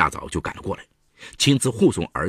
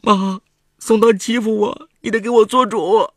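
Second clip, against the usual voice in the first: crying on the phone; 13 semitones higher, 7 dB louder than usual. A narrator's reading. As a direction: wail to her mother-in-law. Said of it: "程婉哭着给婆婆打电话".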